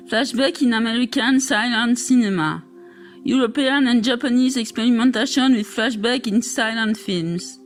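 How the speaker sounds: English titles in a strong French accent. Real, not acted. Reading English chapter titles from a French text, French accent. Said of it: "J'ai un accent terrible".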